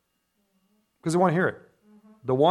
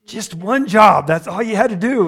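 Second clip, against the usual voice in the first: mock exasperation; 5 semitones higher, 6 dB louder than usual. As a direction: drag out the complaint with emphasis. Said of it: "It's like".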